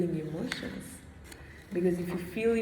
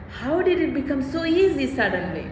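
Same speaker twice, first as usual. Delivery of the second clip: retold shock; 6 semitones higher, 5 dB louder than usual. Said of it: "And I was shocked".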